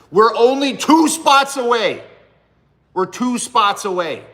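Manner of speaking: high, loud, drawn-out hype; excited announcement. Real, not acted. Streamer rallying fans to download his song, excited shout. Hyping his song's chart position.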